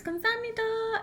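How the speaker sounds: imitating a child's high-pitched thank-you; high and sing-out, like a small boy; little kid's greeting. Mom imitating her son greeting taxi drivers, high child voice. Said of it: "he used to tell the taxi drivers".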